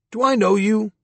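a hint of a bewildered old astronaut; old man just woken, puzzled; confused old man.

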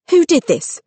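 suddenly angry. Acted. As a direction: demand it like an angry heroine. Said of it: "suddenly angry".

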